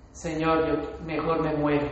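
low, soft, hopeless sigh of a prayer; a hushed, defeated plea to the Lord; despairing prayer. Retold or imitated. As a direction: pray it like you've given up. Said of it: "es orar así".

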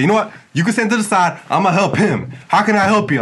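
high, loud brisk cashier voice; mock cashier switch. Cashier turning from the rude customer to him: high loud brisk. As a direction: dismiss one, turn sweetly to the next. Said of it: "he's like".

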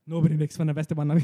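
loud mock soap-villain declaration; dramatic threat. Joking soap opera scene, loud hammed-up villain line. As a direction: bellow it like a telenovela villain.

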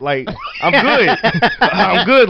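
"I'm good" through laughter; laughing it off. Retold or imitated. Boy brushing off his mom, laughing. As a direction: laugh while brushing off the worry.

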